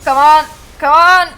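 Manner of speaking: loud, high frustrated yell; frustrated shout. Real, not acted. Frustrated during fight.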